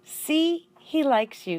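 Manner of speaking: a cheerful man showing off his friend; lightly voiced friendly man; Aldo, pleased.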